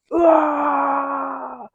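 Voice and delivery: a sudden high straining shout from a heavy lift; effort shout. Real, not acted. Lifter hitting his deadlift record, straining high shout.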